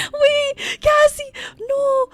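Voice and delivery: high, loud squeals, close to crying; overwhelmed squeal. Real, not acted. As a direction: squeal in happy shock. Overwhelmed by chat's support.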